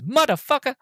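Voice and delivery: high-pitched incredulous curse; mock indignant disbelief. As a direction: react as if outraged at being shot, hammed-up. Blade's reaction to being shot, mimicked.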